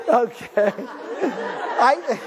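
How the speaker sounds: real laughter breaking through the words; chuckling while comforting someone; laughing gently through reassurance. Real, not acted. Laughs at a crying audience member.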